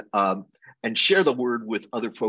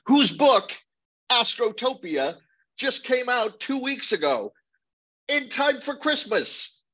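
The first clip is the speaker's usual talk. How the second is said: excited plug; 11 semitones higher than usual. Real, not acted. Plugging a colleague's new book.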